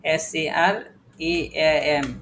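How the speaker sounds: SCR EAM spelled very slowly, letter by letter; slow spelling. Shown as a demonstration. Teacher slowly spelling SCR EAM for the class. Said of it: "spelling slowly".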